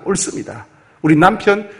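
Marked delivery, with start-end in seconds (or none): 0.0-0.7 옳습니다 repeated softer and lower; quiet echo. Shown as a demonstration. Preacher echoing Abigail's 옳습니다, softer and lower. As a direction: echo 옳습니다 softly to yourself. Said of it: "당신이 옳습니다".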